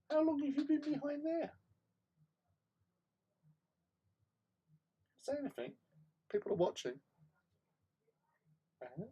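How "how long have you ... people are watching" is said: very high pet-talk voice; talking to the cat. Real, not acted. Owner coos at the cat hiding behind the chair, very high.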